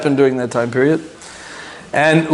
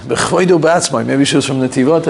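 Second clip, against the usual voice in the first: ordinary talk, nothing marked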